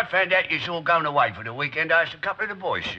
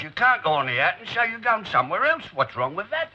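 ordinary talk

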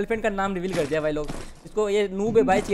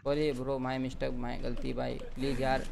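sheepish pleading; 7 semitones lower, 7 dB quieter than usual. Real, not acted. Pleading teammate for revive.

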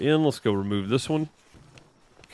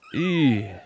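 wincing eee of disgust; squeamish eee; 4 semitones higher, 4 dB louder than usual. Real, not acted. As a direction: cringe out loud. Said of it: "Tears my heart out".

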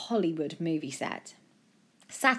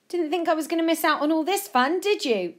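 high, louder, cheeky grin in the voice; grinning; 11 semitones higher, 5 dB louder than usual. A narrator's reading. Friend joining Joe's fun, grinning and high. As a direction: say it through a grin, pitched up. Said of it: "A J grinned back".

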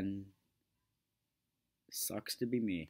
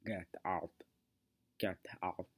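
throat-clogged Terminator voice; 4 semitones lower, 6 dB quieter than usual. Shown as a demonstration. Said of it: "it's clogging your, up your throat".

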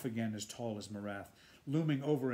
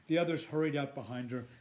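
usual speaking voice

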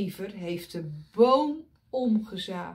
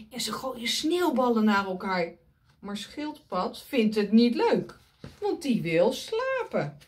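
usual speaking voice

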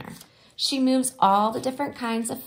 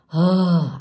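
loud groaning ugh; exasperated ugh; 9 dB louder than usual. Acted. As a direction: let out a fed-up groan. Child exasperated.

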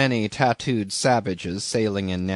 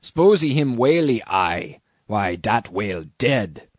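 put-on matter-of-fact islander-accented pidgin; harpooner's broken English. A narrator's reading. Harpooner explaining his throw, broken-English accent. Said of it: "said Queequeg, quietly hauling in the line".

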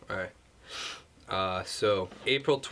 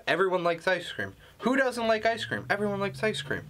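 mock outrage; 8 semitones higher than usual. Mock outrage about ice cream.

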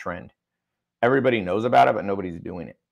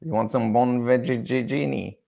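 mock Italian waiter; 3 semitones higher, slower than usual. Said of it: "Sounds like a, a plate of food".

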